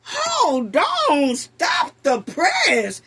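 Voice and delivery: exaggerated shocked outcry; stunned. Real, not acted. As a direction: stop everything, you can't believe your ears. Host reacts to clip.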